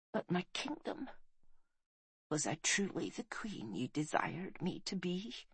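very faint, hushed question; barely-there dying queen; fading dying voice. A narrator's reading. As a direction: ask softly with failing breath. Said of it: "the woman was fading fast".

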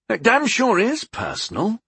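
high, indignant retort; anger pushing the pitch up; angry. A narrator's reading. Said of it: "I said angrily".

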